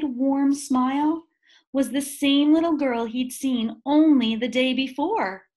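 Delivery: ordinary delivery, nothing marked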